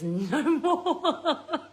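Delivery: high, laughed-out refusal; retold refusal dissolving into giggles; laughing. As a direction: break into laughter on the words. Retelling son's refusal, breaks into laughter.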